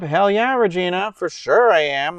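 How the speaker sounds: answering Regina's invite with eager excitement; excited yes. Real, not acted. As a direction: jump at the invite. Eager reply to a chat invite.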